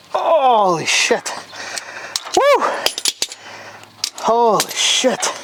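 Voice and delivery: slow, high holy shit; drawn out in disbelief; amazed relief. Real, not acted. Relief after getting it.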